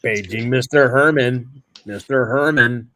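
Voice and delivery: normal talking voice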